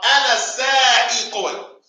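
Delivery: Ana sa'iqun stretched out evenly; slow model phrase. Shown as a demonstration. Teacher leads the class chorus slowly. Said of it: "Say it with me, y'all".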